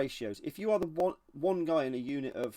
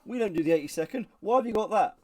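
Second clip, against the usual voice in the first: louder, higher mock challenge; Rob Gurman; 6 semitones higher, 7 dB louder than usual. Friend objects in the group chat, mock challenging. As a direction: call him out in an imitated objection. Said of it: "Rob Gurman goes".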